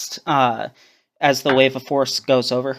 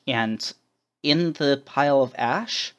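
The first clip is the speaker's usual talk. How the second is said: ordinary talk, nothing marked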